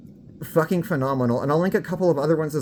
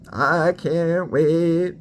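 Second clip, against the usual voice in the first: excited; 3 semitones higher, much slower than usual. Real, not acted. Celebrating her progress.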